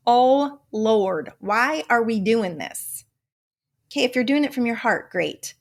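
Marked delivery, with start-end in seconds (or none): 0.1-3.0 drawn-out Southern exclamation; mock-exasperated in a Southern accent; playful Southern lament, slowing down. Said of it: "I always do this Southern accent".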